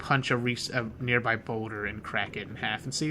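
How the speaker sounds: ordinary delivery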